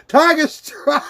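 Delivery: giggling the name out high; cracking up while reading the name; laughing. Real, not acted. Streamer reading a donor name, laughing.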